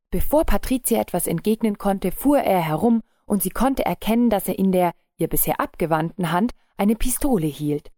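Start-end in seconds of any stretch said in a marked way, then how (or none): none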